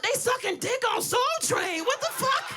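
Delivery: high-pitched, outraged uncle imitation; uncle yelling. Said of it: "he's stomping and yelling".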